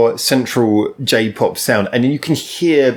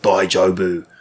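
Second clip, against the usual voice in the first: put-on English accent; 6 semitones lower, slower than usual. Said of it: "he says it in the most English way possible".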